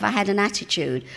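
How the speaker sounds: ordinary talk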